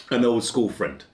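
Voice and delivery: ordinary talk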